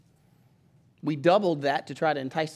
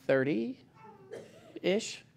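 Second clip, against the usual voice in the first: hesitant, drawn-out, softer answer; sheepish admission; 4 semitones higher, 6 dB quieter than usual. Retold or imitated. Pastor admitting a tiny attendance on the phone, sheepish. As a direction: hedge it with a rising, embarrassed wobble.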